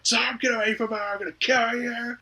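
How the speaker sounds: hammed-up menace; mock threat. Dad threatens Tom.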